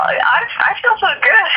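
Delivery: overjoyed after an emotional call; happy, moved. Real, not acted. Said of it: "Call made me feel so happy".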